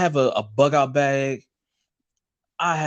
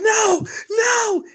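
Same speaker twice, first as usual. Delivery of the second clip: panicked shout. Retold or imitated. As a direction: yell it like you're being handed over. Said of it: "was like".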